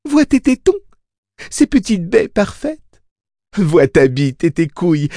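very high, rapturous cooing; purring. A narrator's reading. Mia marvels over every part of her baby, purring. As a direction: purr adoringly in a high voice. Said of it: "ronronna Mia".

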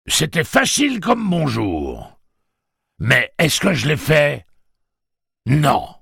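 high, loud, crowing pirate; gleeful pirate. A narrator's reading. Pirate mocking the doubter, gleeful crowing. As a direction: crow it with relish, drawing out the taunts. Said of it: "Le pirate gloussa joyeusement".

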